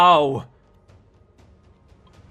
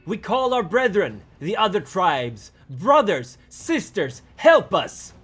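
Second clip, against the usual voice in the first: high, loud cry for help to kin; desperate call; 9 semitones higher, 6 dB louder than usual. A narrator's reading. Tribes calling their brethren and other tribes for help, desperate call. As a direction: call out urgently across a distance.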